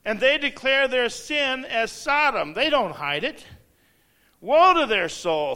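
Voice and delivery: thundering preaching. Acted. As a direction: hurl each verse at the crowd. Reads Isaiah at full force.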